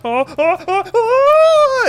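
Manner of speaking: very high, laughing oh-ohs; genie's giggle. Acted. Genie laughs appearing from bell.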